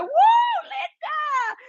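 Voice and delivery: very high whooping cheer; imitated crowd cheer. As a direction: whoop like a wild party crowd.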